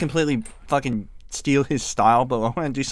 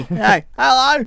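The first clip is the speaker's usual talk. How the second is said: much higher, louder, slow sing-song hello; silly high greeting; 12 semitones higher, 8 dB louder, slower than usual. Pretend. Greeting Mike who just woke up, squeaky high voice. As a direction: squeal a drawn-out hello at your buddy.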